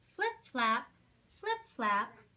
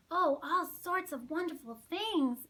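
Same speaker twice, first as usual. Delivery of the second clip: enthusiastic; 6 semitones higher, 6 dB louder than usual. Acted. Mary enthusing.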